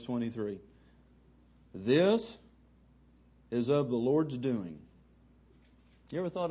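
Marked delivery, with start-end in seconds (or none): 1.7-4.8 scripture reading. Acted. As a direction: preach the verse, lingering on each word. Reads the verse emphatically.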